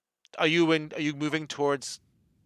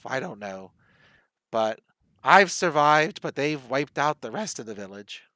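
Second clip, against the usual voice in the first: put-on old woman. Old woman tells visitors who wiped out the village, granny voice. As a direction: tell it haltingly as an ancient survivor. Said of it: "She's like".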